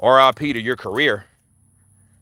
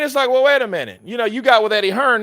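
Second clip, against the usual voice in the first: usual speaking voice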